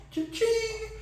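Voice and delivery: high, soft cha-ching; playful quiet cash-register squeal. Pretend. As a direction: imitate a little till ringing, high and light.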